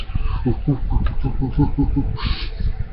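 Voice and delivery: soft, breath-only monkey hooting; nervous ape hoots. A narrator's reading. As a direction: imitate uneasy gorillas hooting. Gorillas react nervously to the roar.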